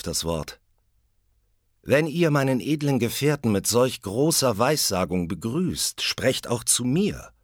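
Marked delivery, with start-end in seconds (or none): 1.8-7.3 higher, louder, insistent warrior; demanding Benko. Acted. Benko insisting the witches speak to him too. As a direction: demand your share of prophecy.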